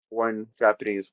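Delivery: normal talking voice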